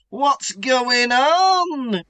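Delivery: high, long-drawn wail of a question; dramatic pleading question. Acted. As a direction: stretch it out in theatrical bewilderment. Voices Harry's frantic question in recap.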